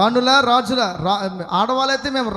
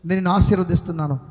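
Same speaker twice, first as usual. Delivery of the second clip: ordinary delivery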